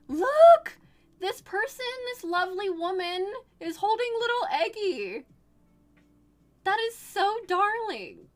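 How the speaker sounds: high, excited squeal of adoration; delighted cooing. Real, not acted. Streamer showing a woman holding Eggie, delighted cooing. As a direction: show it off, overjoyed. Showing viewers the cute picture.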